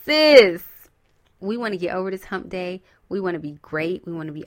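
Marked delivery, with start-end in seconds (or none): 0.0-0.6 loud call of sis. Real, not acted. Calling out loudly.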